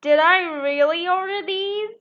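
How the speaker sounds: puzzled farmer. Acted. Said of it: "said the farmer".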